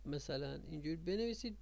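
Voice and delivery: normal talking voice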